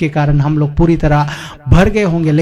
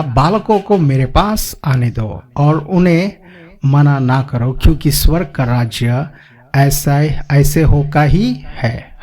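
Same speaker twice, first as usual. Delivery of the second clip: solemn scripture reading; slower than usual. Acted. Reading Jesus' words from scripture.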